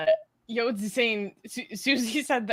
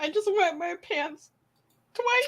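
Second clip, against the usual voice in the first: high voice left over from laughing; giggly squeal; 10 semitones higher than usual. Real, not acted. Host recalling the viewer's comment, giggly and high. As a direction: squeak it out, still tickled.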